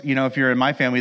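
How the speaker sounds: ordinary talk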